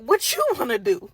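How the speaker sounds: loud retort with a laugh in it; throwing the question back. Retold or imitated. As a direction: fire it back, stressing YOU. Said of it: "I'm like".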